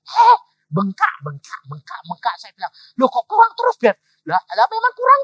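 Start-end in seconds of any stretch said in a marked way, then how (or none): 0.1-2.4 high, loud startled "eh"; acted shock, opening high and loud; mock alarm. Retelling his alarm at costs. 3.0-5.2 high, loud incredulous complaint; loud, high-pitched back-and-forth bickering; acted protest. Acted quarrel over money shortfall.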